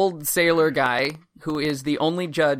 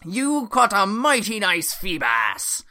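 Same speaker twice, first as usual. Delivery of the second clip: put-on old sailor voice.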